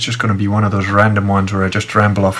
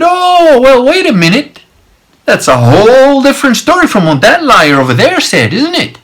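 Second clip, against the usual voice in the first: mock astonishment; 13 semitones higher, 5 dB louder than usual. Man catches guard's lie before lady, mock-astonished. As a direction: exaggerate the "well, wait a minute" comeback. Retold sarcastic comeback in argument.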